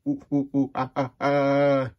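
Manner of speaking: monkey hoots. Acted. Monkey reading you this book, playful monkey hooting. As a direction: hoot like a cartoon monkey. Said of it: "my monkey voice".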